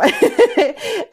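a burst of high-pitched giggling; high laugh. Real, not acted. Laughing at herself mid-tangent on the podcast, a high giggle. Laughing at her own joke.

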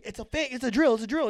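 high, hurried mock warning; urgent calling out. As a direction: call it out to stop someone fleeing. Staff calling out to fleeing kid.

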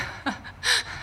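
breathless high-pitched laugh; a high unvoiced burst of laughter. Real, not acted. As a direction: let out a squeaky giggle. Laughter after teasing question.

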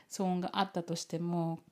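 ordinary delivery

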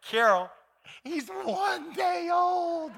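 high, slow, cracking cry of disbelief; incredulous laughing protest. Retold or imitated. Husband exclaims to his weeping wife in disbelief. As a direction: stretch it out, half laughing in disbelief.

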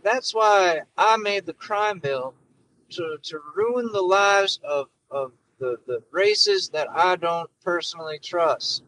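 mock Biden giving a folksy speech; hammed-up Biden addressing folks; Joe Biden impression. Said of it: "shitty Joe Biden impression".

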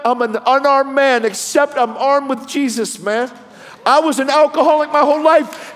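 very high, loud impassioned preaching; full-force preaching. Retold or imitated. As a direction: cry out the gospel as a fired-up preacher.

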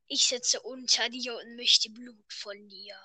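slow eerie whisper from below; ghost whisper. Acted. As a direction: breathe it like a ghost under the floor. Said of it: "hört er eine Stimme von unten". (geflüsterte Geisterstimme)

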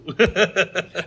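real laughter, loud and high; hearty laugh at the joke. Real, not acted. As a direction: burst out laughing. Co-host laughs at punchline.

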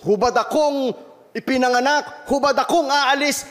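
high, pressing sermon voice building through Job's losses; impassioned preaching. Acted. Pastor retells Job's trials to the church, preaching high and impassioned. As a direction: preach the story of Job with rising force. Climax quoting Job's praise.

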